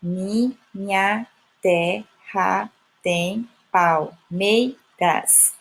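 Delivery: very slow, each syllable set apart; chopped, measured scansion of the whole line. Shown as a demonstration. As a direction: count out every syllable with a clear gap. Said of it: "vou perceber que tem oito sílabas".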